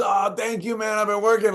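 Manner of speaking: high-pitched, gushing gratitude; acted delighted thanks. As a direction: imitate a thrilled artist being praised. Acts the painter's delighted thanks.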